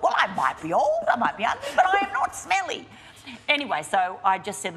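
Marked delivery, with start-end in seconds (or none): 0.0-2.9 mock outrage that climbs into a laughing shout; indignant retort. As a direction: build the comeback step by step until it bursts out. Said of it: "I thought".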